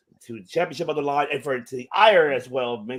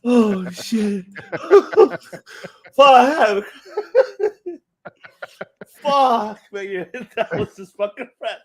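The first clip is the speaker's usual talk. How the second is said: cursing through loud laughter; swearing tirade; 8 semitones higher, 7 dB louder, slower than usual. Real, not acted. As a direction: crack up and swear. Cohost cracks up at coach's joke.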